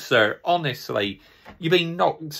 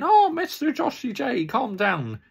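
high, sing-song voice of the critics telling him to calm down; mocking the critics; 9 semitones higher than usual. Fan mimics viewers telling him to calm down, mocking. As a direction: lightly sneer at the people telling him to calm down. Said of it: "I bet people will be thinking".

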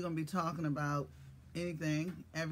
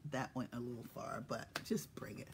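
whisper; 6 semitones lower, 8 dB quieter than usual. Real, not acted. Playful aside after asking too much.

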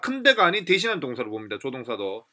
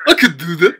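"can" swallowed to "k'", DO punched loud; stress on DO; 9 dB louder, much slower than usual. Shown as a demonstration. Teacher copying American speakers, loud stress on "do". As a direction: hit DO hard, rising at the end. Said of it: "흑형도 I can do that".

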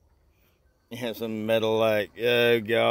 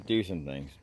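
usual speaking voice

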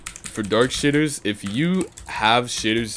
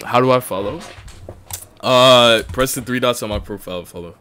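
ordinary talk, nothing marked

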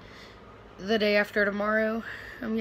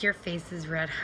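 lower, lightly put-on mom voice; mock mom voice; 4 semitones lower, 3 dB quieter than usual. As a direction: mimic mom in an exaggerated low tone. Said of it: "she didn't say it like that".

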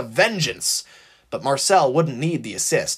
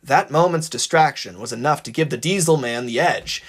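plain reading voice

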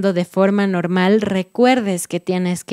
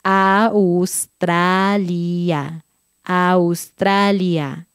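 "Australia" drawn out syllable by syllable; very slow careful "Australia"; slowly; much slower than usual. Shown as a demonstration. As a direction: stretch every vowel of "Australia".

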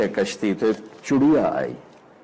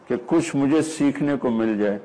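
normal talking voice

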